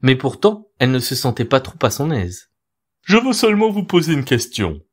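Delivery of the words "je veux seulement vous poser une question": higher, honeyed owl voice; butter the mouse up; smooth-talking owl. A narrator's reading. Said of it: "sur un ton patelin".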